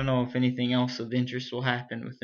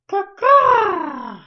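loud high crow caw; bird caw; 11 dB louder than usual. Acted. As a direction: squawk it like a crow. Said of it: "Did you like that caw?".

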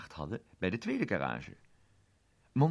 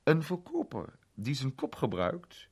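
Monty-stem; 8 semitones higher, 4 dB louder than usual. Acted. Monty citeert zijn handleiding.

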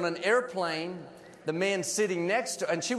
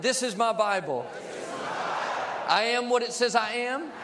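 leading a congregational confession. Real, not acted. Preacher leads the church in a Bible confession, declaimed phrase by phrase. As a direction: declaim it in short phrases, pausing for repeats.

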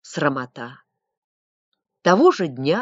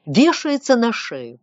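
ordinary delivery, nothing marked

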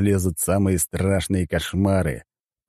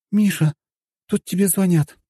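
high-pitched female character voice; high woman's call; wife calling out; 11 semitones higher, 3 dB louder than usual. A narrator's reading. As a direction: call to your husband in a high, light voice. Wife calls to hero in story.

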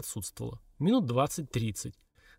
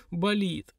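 mild higher-pitched whimper; 6 semitones higher than usual. A narrator's reading. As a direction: whimper it lightly over a sore head. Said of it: "прохныкала Лилька". (слегка хныча)

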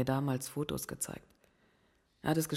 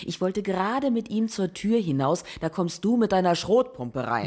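reproachful; 5 semitones higher, 6 dB louder than usual. Acted. Linda wirft Hopkins Vorwürfe vor.

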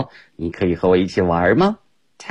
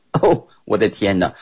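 ordinary delivery

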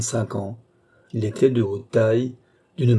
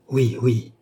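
quick, eager, convinced yes; lively conviction. A narrator's reading. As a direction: insist briskly, sure of it. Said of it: "avec la vivacité de l'homme convaincu".

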